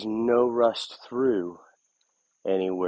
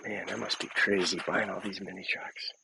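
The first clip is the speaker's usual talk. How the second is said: whispering; 8 dB quieter than usual. Real, not acted. Aside to himself.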